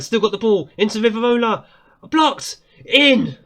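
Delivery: rising to a high loud cry of blocked; live goalmouth thrill; excited commentary. Real, not acted. Near-goal chance, commentating.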